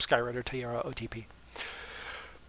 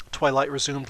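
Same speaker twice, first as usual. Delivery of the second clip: ordinary delivery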